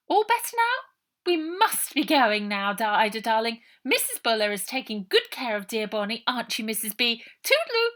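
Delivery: high, loud breezy goodbye; posh farewell. A narrator's reading. Posh aunt leaving the matron, breezy and high.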